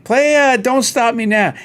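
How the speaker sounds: high, loud eager request; mock eager bar patron; calling out request. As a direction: shout the song title to the stage.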